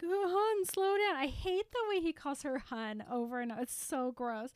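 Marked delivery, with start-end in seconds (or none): 0.0-1.2 high, loud mock pleading; imitating boyfriend. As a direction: call after her, clingy. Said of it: "he's like".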